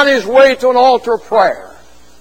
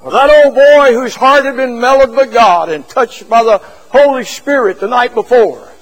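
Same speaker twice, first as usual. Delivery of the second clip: ordinary delivery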